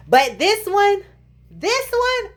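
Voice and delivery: THIS ONE pitched high and drawn up; emphatic. Real, not acted. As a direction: hit "this one" high, twice. Contrasts real bump with fake one.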